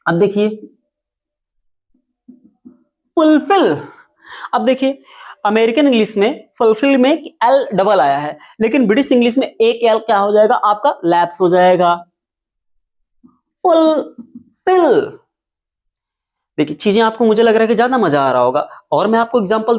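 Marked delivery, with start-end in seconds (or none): 3.2-3.8 high, ringing dictation call; announcing example word. Shown as a demonstration. Teacher calls out the example word. 13.7-15.2 high, slow, split in two; dictating by syllables. Shown as a demonstration. Teacher dictates word while writing it.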